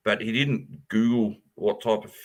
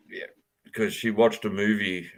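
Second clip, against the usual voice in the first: ordinary talk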